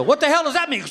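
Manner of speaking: high, loud snippy wife demanding an answer; indignant wife. Acted. Wife offended by her husband's glance, indignant. Wife voiced reacting to eye.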